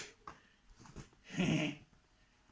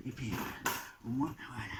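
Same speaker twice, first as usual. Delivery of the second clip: whispered so the pet stays asleep; 5 semitones lower, 6 dB quieter than usual. Pretend. Entertainer whispering over the sleeping pet, unvoiced hush. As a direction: breathe it out with no voice.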